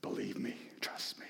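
intimate whispered plea. Acted. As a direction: whisper it close to his ear. Jesus whispers to Jairus.

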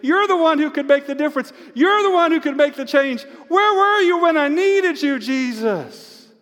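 loud, high wail of anguished pleading; crying out. Acted. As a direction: cry it out at full voice, falling away on the last word. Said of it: "but she's crying out to him".

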